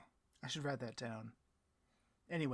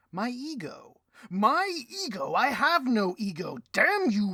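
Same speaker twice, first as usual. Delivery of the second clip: high, loud ranting shout; furious outburst; 9 semitones higher, 15 dB louder than usual. Acted. Boss raging at his snarky right hand, high furious shout. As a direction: explode at the insult.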